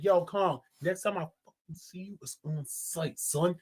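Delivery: low, hushed warning; quiet menace trailing down low; imagined Godzilla threat. Acted. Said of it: "Godzilla said".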